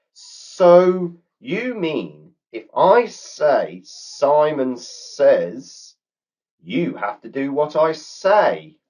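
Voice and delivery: high, slow, scheming snake voice; a snake realising a trick, savouring it; sly grinning snake. A narrator's reading. Snake grinning at the girls' rule, sly slow snake voice. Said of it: "a big grin appeared on his face and said".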